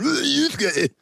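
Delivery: high, hammed-up cartoon-dub call; imitating Kuwabara. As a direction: call the name like a dubbed sidekick. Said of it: "He's just going".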